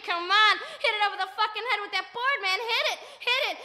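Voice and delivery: urgent acted yell, fast in the middle; screaming. Onlooker egging his friend on to hit it, screaming. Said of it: "and I'm screaming".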